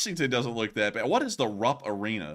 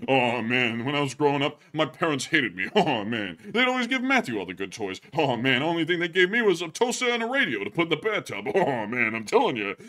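self-pitying comedian's complaint; hard-luck stand-up comic; 2 semitones higher than usual. Acted. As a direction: grumble through a put-upon comic routine. Hard-luck comic bit, no respect.

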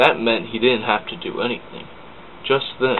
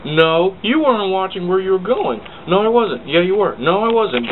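put-on high, loud childish back-and-forth; bickering; 9 semitones higher, 4 dB louder than usual. Two engines squabbling, high and loud. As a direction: squabble like kids.

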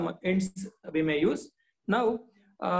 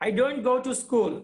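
angry cry; 6 semitones higher than usual. Acted. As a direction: push back hard against going to school. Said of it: "cried Swami angrily".

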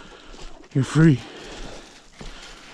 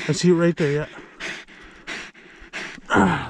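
ordinary delivery

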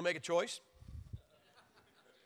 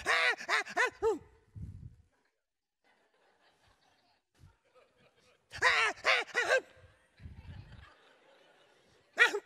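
repeated high, loud mock baby shrieks; hammed-up baby crying in bursts; imitated baby wail; 6 dB louder than usual. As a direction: scream like an infant on a cactus. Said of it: "you sounded off like they laid you on a cactus".

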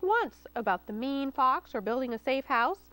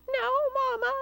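high, loud piglet squeal; 13 semitones higher, 4 dB louder than usual. A narrator's reading. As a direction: squeal like a frightened little pig. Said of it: "Oinky squealed".

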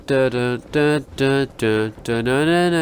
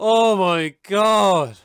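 slow, drawn-out gasp of shock, a bit higher; shaken exclamation; 5 semitones higher, much slower than usual. Real, not acted. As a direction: still reeling from the scare. After jump scare.

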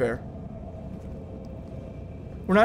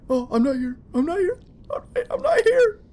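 scared whimper; 9 semitones higher than usual. Real, not acted. Hiding from monster, pleading.

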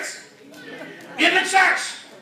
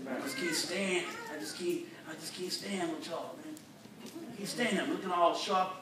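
soft, low jealous grumble; mock grumbling; 5 semitones lower, 9 dB quieter than usual. Preacher playing a jealous member, low soft grumbling. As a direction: mutter it like a sulking churchgoer.

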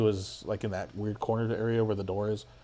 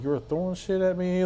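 much higher, mildly mock-incredulous; incredulous retort; 9 semitones higher than usual. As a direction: retell an accusation in a raised pitch. Retelling his incredulous confrontation with girlfriend.

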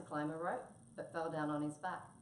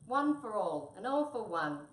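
loud, raised, grand declaration; grandly; 5 semitones higher, 8 dB louder than usual. A narrator's reading. Tabby vows to stand by his friends, grandly. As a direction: proclaim it like a noble hero. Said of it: "said Tabby grandly".

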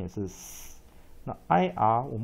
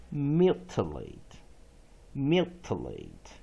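slow, careful "mutilate" with pitch falling each time; slow teacher demo; slow model reading; 2 semitones lower, slower than usual. Shown as a demonstration. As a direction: read "mutilate" syllable by syllable, deliberately. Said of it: "One more time".

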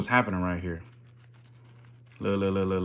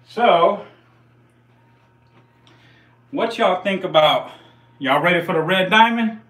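hyped up; 8 semitones higher, 7 dB louder than usual. Real, not acted. Hyping next snake.

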